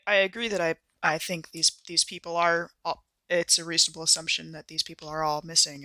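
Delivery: ordinary delivery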